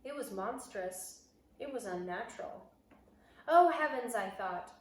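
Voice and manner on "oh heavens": 1 panicked gasp. Acted. Boy terrified in hiding.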